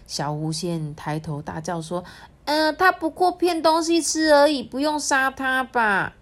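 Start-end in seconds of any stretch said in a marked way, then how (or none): 2.4-6.2 high pleading fox fairy voice; high little voice pleading. Acted. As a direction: beg in a small high voice.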